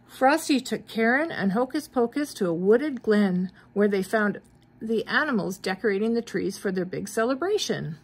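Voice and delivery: ordinary delivery, nothing marked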